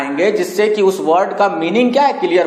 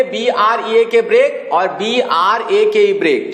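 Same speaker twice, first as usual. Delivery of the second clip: spelling it out; slower than usual. Shown as a demonstration. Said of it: "spelling अलग है".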